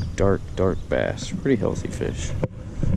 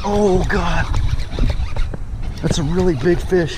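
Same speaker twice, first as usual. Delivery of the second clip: excited; 9 semitones higher than usual. Real, not acted. Angler to himself as a huge fish hits, excited and high. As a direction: gasp it out as the big one fights.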